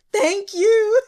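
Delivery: high, loud and laughing; a squealed, giddy thank you; gleeful thanks. Retold or imitated. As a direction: shriek it out with delight. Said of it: "I said in my notes".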